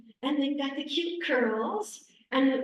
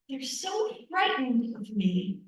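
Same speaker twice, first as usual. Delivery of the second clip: ordinary talk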